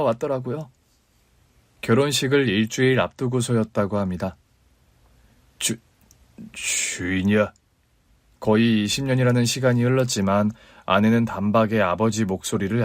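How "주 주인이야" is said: low, slow, halting; a father unsure he may even say her name; hesitant old father. A narrator's reading. Estranged father calling his daughter, low, slow and hesitant.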